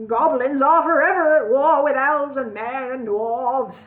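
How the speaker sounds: loud, slow villain shout. A narrator's reading. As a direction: roar the eternal war. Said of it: "shouted Cluck".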